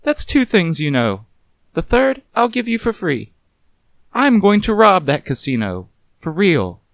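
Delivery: sharp, mocking movie star voice; Vivian, mocking. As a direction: scoff at the detective coolly.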